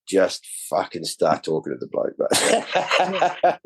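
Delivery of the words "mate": laughing. Real, not acted. Laughs at his own punchline.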